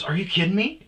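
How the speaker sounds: high, loud imitation of disbelief; mock incredulity. Professor questioning his stoned student, loudly incredulous.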